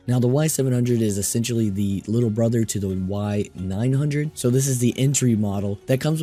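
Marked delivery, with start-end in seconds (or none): none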